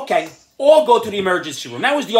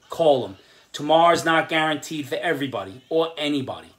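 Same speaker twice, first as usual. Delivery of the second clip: sincere grief; 6 semitones lower, 4 dB quieter than usual. Real, not acted. Grief over grandfather.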